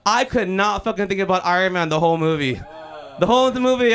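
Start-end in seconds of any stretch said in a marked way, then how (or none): 3.2-3.9 loud, high, worked-up rant; worked-up complaint. Acted. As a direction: belt it out like it still stings. Obsessing over an insult.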